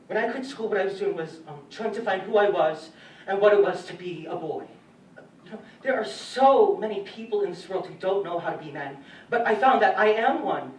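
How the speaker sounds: loud, emphatic testimony; impassioned declaration. Acted. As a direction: build to a forceful, high declaration. Impassioned account of finding manhood.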